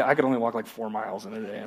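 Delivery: low, very soft mock confession; sheepish admission. Listener admitting how far they walk, hushed and low. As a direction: drop to an undertone, as if admitting it. Voices a reluctant, embarrassed admission.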